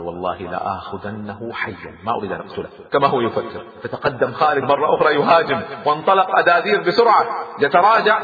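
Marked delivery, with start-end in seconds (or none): 0.0-2.7 hushed, measured determination; private resolve. A narrator's reading. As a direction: swear it quietly to yourself. Khalid privately resolves, swearing.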